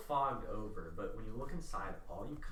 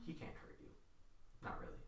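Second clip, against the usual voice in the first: very soft, near-whispered reply; hushed unmasked stranger; 11 dB quieter, faster than usual. Acted. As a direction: answer barely above a breath. GM voices the unmasked man.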